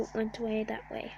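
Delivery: ordinary talk, nothing marked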